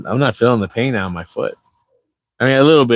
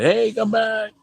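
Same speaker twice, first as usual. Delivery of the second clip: high voice; 13 semitones higher, 3 dB quieter, slower than usual. Retold or imitated. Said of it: "they wake me up and say".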